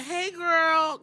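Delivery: high, sugary fake hello; shady greeting. Asuka greeting her rival with high, fake-sweet shade. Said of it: "giving her all that shade".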